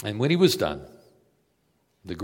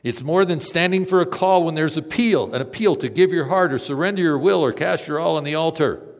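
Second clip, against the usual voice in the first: loud, high preaching; fervent pulpit delivery; preaching at full force; 6 semitones higher, 10 dB louder than usual. Acted. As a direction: proclaim it to the back row.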